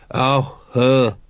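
mildly gloomy recognition, two separate words. A narrator's reading. Vimes recognising the lady Colon suggests, gloomy. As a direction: let it out with a touch of gloom. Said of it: "said Vimes gloomily".

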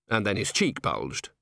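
usual speaking voice

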